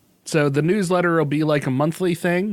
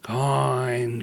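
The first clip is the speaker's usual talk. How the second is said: mummy coin voice. Pretend. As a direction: groan it like a mummy. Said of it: "Can you throw that in one more time".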